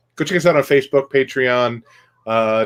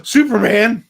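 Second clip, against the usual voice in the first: mock gasp; 12 semitones higher, 5 dB louder, slower than usual. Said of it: "and they go".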